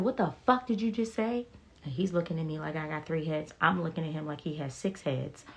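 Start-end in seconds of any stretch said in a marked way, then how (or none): 0.0-1.5 high, indignant retelling of cussing someone out; retold angry outburst. Patient cussing out the resident over a partial mastectomy, angry retelling. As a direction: retell losing it, pitched up in disbelief. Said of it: "immediately lost my shit".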